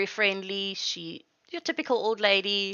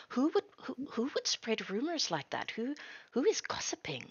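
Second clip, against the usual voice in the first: softer, higher, eager whisper; eager hushed whisper; 6 semitones higher, 6 dB quieter than usual. Acted. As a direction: feign disinterest but whisper keenly. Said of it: "hoping that you don't notice".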